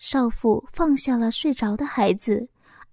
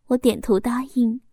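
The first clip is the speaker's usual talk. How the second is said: plain reading voice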